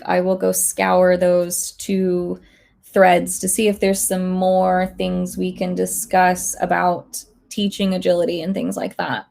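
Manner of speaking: usual speaking voice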